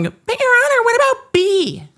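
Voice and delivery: high, whiny put-on lawyer voice pleading to the judge; mocking opposing lawyer. Opposing counsel whining to the judge, mocking high whine. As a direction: mimic a petty lawyer whining at the bench. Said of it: "the other side's coming in and going".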